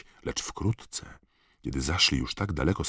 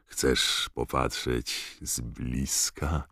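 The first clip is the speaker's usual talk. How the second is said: slow, softer, lightly voiced giant; excited Hagrid; 3 semitones lower, 5 dB quieter, much slower than usual. A narrator's reading. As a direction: invite her closer in a hushed voice. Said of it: "zapytał Hagrid podnieconym głosem".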